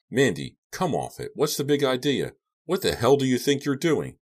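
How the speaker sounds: high-pitched, incredulous demand; exasperated, rising outburst; indignant protest. A narrator's reading. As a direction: demand answers, voice jumping up. Captive protests to his captor.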